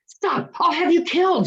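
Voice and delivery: the king shouts a death threat; shouted. A narrator's reading. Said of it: "shouted the king".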